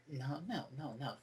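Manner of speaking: very soft string of refusals; quiet correction. Retold or imitated. Fan voicing the Doctor interrupting her demand, quiet correction. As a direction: hush the demand softly.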